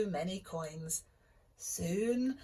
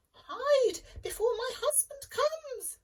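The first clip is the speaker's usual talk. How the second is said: much higher, urgent kind lady; lady's voice. A narrator's reading. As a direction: warn him to hide, high and quick. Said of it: "said the lady".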